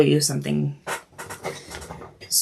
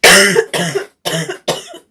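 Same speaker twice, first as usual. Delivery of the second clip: a loud, hacking coughing fit; real coughing fit; 9 dB louder than usual. Real, not acted. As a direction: cough hard through a bad cold. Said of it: "I'm really hating this cold".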